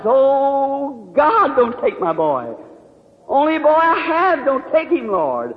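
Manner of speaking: high, drawn-out plea without real screaming; retold desperate scream. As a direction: cry out to God, stretching the words. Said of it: "I was screaming to the top of my voice".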